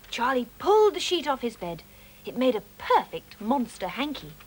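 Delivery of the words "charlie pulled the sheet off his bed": ordinary delivery, nothing marked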